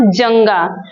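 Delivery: normal talking voice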